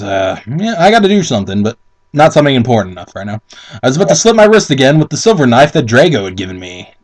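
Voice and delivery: usual speaking voice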